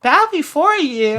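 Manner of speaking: put-on high kid voice; Goten. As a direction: play a young boy asking cheerfully. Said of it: "we should get Goten".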